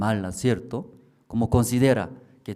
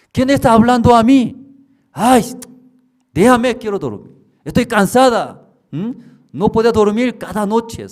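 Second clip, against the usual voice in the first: high, loud whiny complaint; woman woken at night, high and loud; annoyed sleeper; 12 semitones higher, 12 dB louder than usual. Retold or imitated. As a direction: push the voice away, half asleep.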